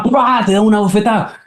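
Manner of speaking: high pua for a slap; slap sound. Acted. Mother slaps the child, high mock slap sound. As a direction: punch out the slap noise.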